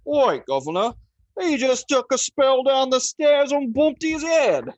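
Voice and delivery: high, loud, slow hammed-up Cockney constable; mock Cockney policeman, badly done; awful Cockney accent. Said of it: "with his awful accent".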